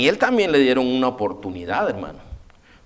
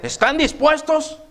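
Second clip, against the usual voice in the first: much higher, pressing question; demanding king; 11 semitones higher than usual. Retold or imitated. King pressing the three young men, high demanding question. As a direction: push them for an answer. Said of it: "le dice".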